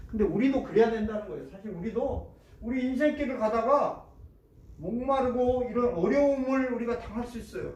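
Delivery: ordinary delivery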